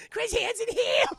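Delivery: high, loud "Chris Hansen here?"; loud joke. Real, not acted. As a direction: bark out the punchline. Joke shout.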